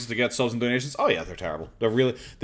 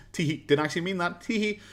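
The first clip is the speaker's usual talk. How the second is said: higher, cutesy put-on tittering; mock coy takeback; 6 semitones higher than usual. Commentator mimicking a YouTuber denying he begged, mock coy. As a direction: imitate someone coyly taking it back. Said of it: "begging and then going".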